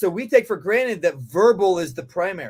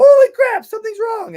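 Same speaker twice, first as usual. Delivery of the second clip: much higher and louder, alarmed; a startled dog sensing danger; dog panicking; 10 dB louder than usual. Retold or imitated. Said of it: "They will go".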